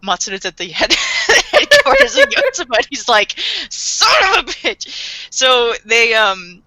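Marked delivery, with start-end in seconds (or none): 3.7-5.3 mock shocked curse. Matsuda exclaims in shock.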